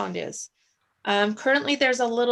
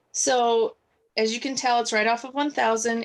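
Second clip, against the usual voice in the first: ordinary talk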